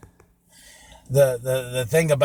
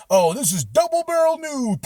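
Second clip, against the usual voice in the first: pitched up, hammed-up news intro; announcer voice; 10 semitones higher than usual. Host mimicking his old show intro, high hammed-up announcer voice. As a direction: hype the segment title like a cheesy announcer. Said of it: "We're like".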